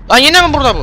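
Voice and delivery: high startled yell; alarmed shout. Real, not acted. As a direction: shout in shock. Creature appears again.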